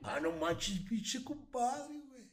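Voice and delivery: high, drawn-out northern Mexican accent from Mexicali; hammed-up Baja California twang, slow on compadre; exaggerated Mexicali accent, stretched high. Shown as a demonstration. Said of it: "¿Y Mexicali?".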